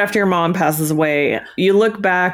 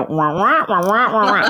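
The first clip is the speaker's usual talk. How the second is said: muffled trombone wah wah; Charlie Brown adult voice. Retold or imitated. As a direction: mimic the Peanuts teacher's garbled drone. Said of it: "it's like Charlie Brown".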